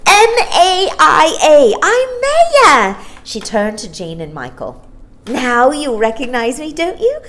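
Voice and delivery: put-on very high, loud, gleeful little girl; delighted child. Star-child greets Jane and Michael, loud delighted little girl. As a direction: bubble over with joy. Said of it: "hopped on one leg delightedly".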